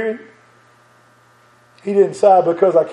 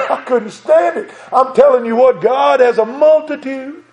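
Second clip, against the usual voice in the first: raised, driving sermon voice with bursts of laughter; fervent preaching; 5 semitones higher than usual. Acted. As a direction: preach it out with joy to the congregation. Preaching builds up.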